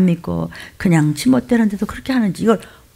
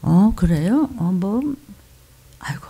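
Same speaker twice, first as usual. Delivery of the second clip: imitating an indifferent shrug-off; breezy, couldn't-care-less mock reply; nonchalant heiki shrug. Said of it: "헤이끼가 뭐냐면". (태연한 척 흉내)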